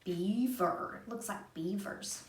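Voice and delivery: ordinary delivery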